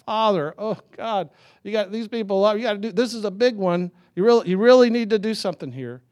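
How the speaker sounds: high, urgent begging prayer; hammed-up imploring plea to God; mock pleading. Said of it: "Jesus didn't plead. He didn't say".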